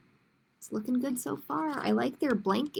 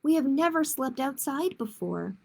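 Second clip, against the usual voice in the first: put-on reading to children, clear and lively; picture book read aloud; story narration. A narrator's reading. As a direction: tell it like storytime for kids. Librarian reads picture book aloud.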